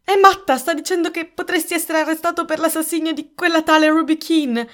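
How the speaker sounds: high, loud panicked burst; breathless outburst. A narrator's reading. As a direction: blurt it out, agitated and high-pitched. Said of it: "Dinah proruppe ansimando".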